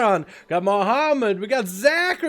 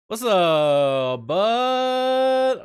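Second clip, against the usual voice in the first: cringy intro; 3 semitones higher, much slower than usual. Shown as a demonstration. Streamer trying out a different intro, cringy and drawn-out. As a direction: stretch it into an overdone streamer greeting. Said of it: "something cringy".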